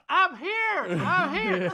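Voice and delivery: high, tiny I'm here with a laugh; Horton's Who voice. Retold or imitated. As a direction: squeak like a speck-sized Who calling out. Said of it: "you're in the purse screaming now".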